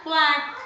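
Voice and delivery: put-on teacher shows the duck's sound, quacking for kids; duck quack imitation. Shown as a demonstration. Teacher tells students the duck sound, mock quack. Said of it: "The sound of the duck is quack".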